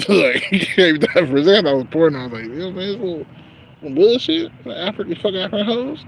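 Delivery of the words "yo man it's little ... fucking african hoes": high, loud mock outrage; laughing, high retold rant; excited. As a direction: ham up the first reaction. Quoting own reaction.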